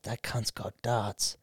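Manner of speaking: slow, soft conspiratorial aside; hushed. Retold or imitated. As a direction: lean in and say it low and slow. Whispering excitedly to his mate.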